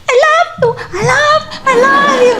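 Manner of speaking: very high, squeaky imitation of a dog speaking; talking dog. Owner imitating dog's words, talking dog. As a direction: mimic a dog's high little voice.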